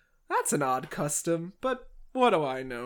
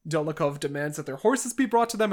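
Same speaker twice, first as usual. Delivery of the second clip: ordinary talk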